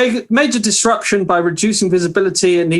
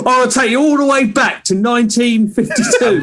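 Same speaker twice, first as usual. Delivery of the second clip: put-on cockney accent; 6 semitones higher than usual. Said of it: "Why did you do it in a Cockney voice?".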